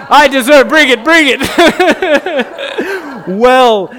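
high, loud laughing concession; real laughter through the words; cracking up while owning the joke. Real, not acted.

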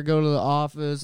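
ordinary delivery

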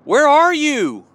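desperate psalm cry, building up. Retold or imitated. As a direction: cry out to God in anguish, pleading. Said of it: "He's crying out like".

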